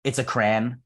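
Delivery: crayon said as "cran"; one-syllable crayon. Shown as a demonstration. Insisting on his own pronunciation, one-syllable crayon "cran". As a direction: squash crayon into one syllable rhyming with "man". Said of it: "It's not a crayon. It's a crayon".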